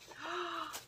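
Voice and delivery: soft, high gasp; real gasp of surprise; delighted gasp. Real, not acted. Finding a surprise in the gift, delighted gasp. Discovers rose sachet in gift.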